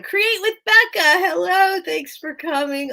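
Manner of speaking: bright high welcome to a viewer; excited greeting. Real, not acted. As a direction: greet the newcomer with a burst of joy. Greeting live chat viewer, excited.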